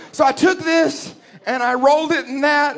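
high, bright wife voice; cheerful explaining; chirpy wife. Retold or imitated. Wife shows husband her recipe, high and chirpy.

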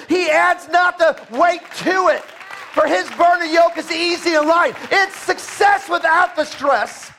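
high, loud preacher shout; full force preaching. Acted. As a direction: drive it home like a revival preacher.

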